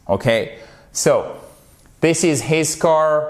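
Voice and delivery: ordinary delivery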